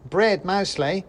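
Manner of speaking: high, loud squeaky reply; a tiny soldier stating the obvious; mouse soldier. Acted. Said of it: "said the soldier".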